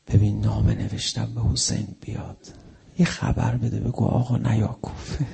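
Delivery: hushed words into his ear. Retold or imitated. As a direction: lean in and say it softly. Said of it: "در گوشش برگشت گفت".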